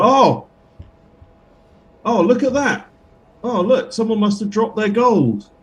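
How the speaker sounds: a high theatrical gasp at the gold; high mock-surprised character voice. Character pretending to spot dropped gold, high hammed-up voice.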